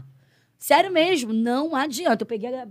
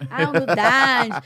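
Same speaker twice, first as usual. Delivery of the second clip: drawn-out mock sigh; 3 semitones higher, 7 dB louder, much slower than usual. Mocking what people say.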